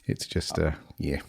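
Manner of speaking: low, soft, croaky with a cold; real head-cold croak, trailing away low; gravel voice. Real, not acted. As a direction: let it sink into a quiet gravelly croak. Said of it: "Oh, gravel voice".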